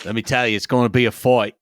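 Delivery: Australian "fight" said "foyt"; a hammed-up Aussie promising a fight; bad Australian accent. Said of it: "no more bad Australian accents".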